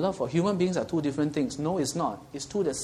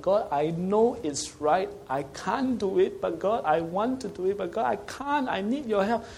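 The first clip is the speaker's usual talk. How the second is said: higher, slow, pleading cry to God; 7 semitones higher than usual. Acted. Believer crying out to God, high and pleading. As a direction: plead upward like a desperate child. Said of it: "you need to cry out to God".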